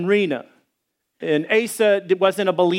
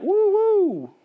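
very high siren-like woo, imitating an alarm; 14 semitones higher than usual. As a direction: wail it like a mock alarm. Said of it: "disciples' show up and be like".

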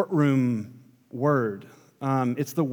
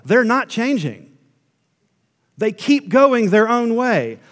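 louder, higher preaching, voicing God's verdict; preaching at full force; 5 semitones higher, 5 dB louder than usual. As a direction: build the charge like a prosecutor.

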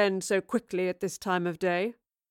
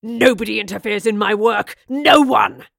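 furious yell, much louder on the end; scream; 10 dB louder than usual. Acted. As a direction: explode with rage. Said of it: "he screamed".

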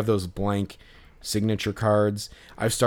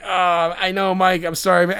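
higher, louder sheepish apology; a bit aggro apology; 8 semitones higher, 4 dB louder than usual. Retold or imitated. Fan apologizing to the ballplayer, higher and a bit aggro. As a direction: say sorry with a little edge. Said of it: "I said that a little, maybe a little more aggro".

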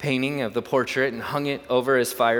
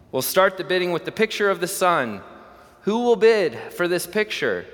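higher, louder auctioneer calling out bids; 7 semitones higher, 4 dB louder than usual. Acted. Auctioneer calling to the crowd, raised and high. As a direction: open the bidding to the room.

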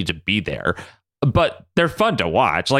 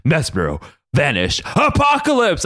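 Xavier. Retold or imitated. Xavier coming back from Mesmero's brain, mock-dramatic. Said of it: "Xavier being like".

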